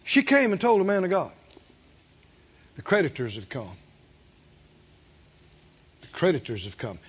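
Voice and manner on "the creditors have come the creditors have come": low, quiet widow's plea; hushed, fearful plea. Retold or imitated. Widow begging the man of God, low and hushed. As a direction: softly beg the prophet for help.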